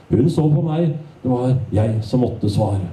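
ordinary talk

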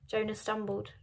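usual speaking voice

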